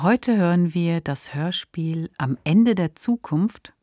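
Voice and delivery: ordinary talk, nothing marked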